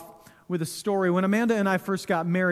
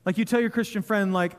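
ordinary talk, nothing marked

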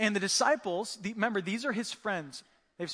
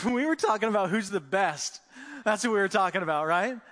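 louder, quick, laughing admission; sheepish laughing confession; 6 dB louder than usual. Retold or imitated. Disciples admitting to Jesus, laughing sheepishly. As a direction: laugh it out like caught kids.

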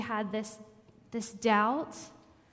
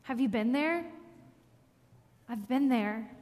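ordinary talk, nothing marked

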